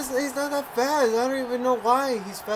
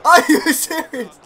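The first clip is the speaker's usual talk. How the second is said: shocked shout; 7 semitones higher, 15 dB louder than usual. Real, not acted. Shocked at a goal.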